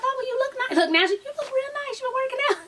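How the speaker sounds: high-pitched mock compliment; imitated small talk. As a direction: mimic her making small talk in a high voice. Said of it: "she's trying to make small talk".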